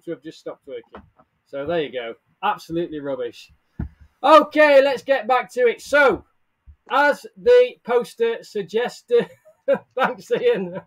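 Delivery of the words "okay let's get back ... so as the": burst of energy. Real, not acted. Regaining energy after tech trouble.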